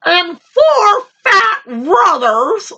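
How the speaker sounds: high, slow little rabbit voice; rabbit impression. Little rabbit inviting the lion to supper, high and slow. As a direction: squeak it like a small bold rabbit.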